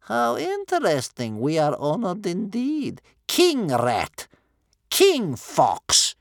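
high, loud mock reverence; mock-honour him in grandmother's voice; sarcastic. A narrator's reading.

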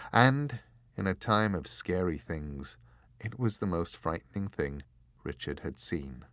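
usual speaking voice

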